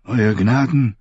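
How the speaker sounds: aged, cool formal address; cool old maester. A narrator's reading. Maester Aemon addresses King Stannis, cool and aged. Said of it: "sagte kühl wie immer".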